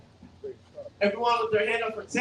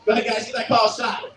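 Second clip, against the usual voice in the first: usual speaking voice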